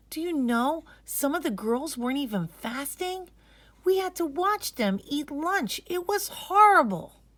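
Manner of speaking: a sister venting about her day; upset schoolgirl. Acted. Sister complaining to her brother about school lunch.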